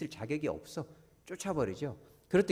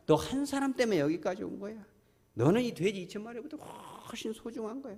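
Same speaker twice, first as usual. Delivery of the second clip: higher, soft, loving assurance; tender Jesus; 6 semitones higher, 3 dB quieter than usual. Retold or imitated. Jesus reassuring the healed man, high and tender. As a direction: speak to a frightened child with love. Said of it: "이렇게 말하고 있는 거예요".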